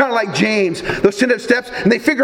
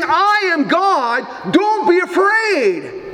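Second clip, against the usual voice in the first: higher, slow, half-shouted proclamation; preaching at full force; 6 semitones higher, slower than usual. Acted. Preacher voices Jesus reassuring disciples in storm, proclaiming. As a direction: proclaim it over the storm, each word weighed.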